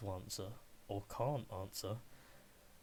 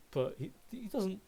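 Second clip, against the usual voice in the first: normal talking voice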